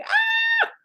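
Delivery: sudden high ecstatic "ah" of release; ecstatic cry. Pretend. Coach acting out the joy of flow, ecstatic cry. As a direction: let out a joyful high cry like it pours out of you.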